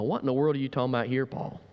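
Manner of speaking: usual speaking voice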